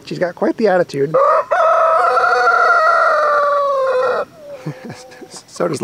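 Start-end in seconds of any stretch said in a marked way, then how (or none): 1.2-4.2 high-pitched laugh. Real, not acted.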